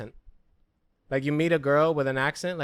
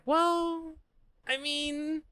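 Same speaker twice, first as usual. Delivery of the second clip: much higher, drawn-out hesitation; hesitant mock; 12 semitones higher, 4 dB quieter, much slower than usual. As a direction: stall awkwardly. Hesitant reply to friend.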